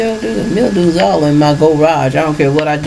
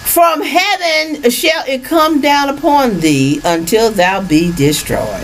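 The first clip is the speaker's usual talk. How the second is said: scripture reading; 7 semitones higher, slower than usual. Acted. Reads scripture aloud.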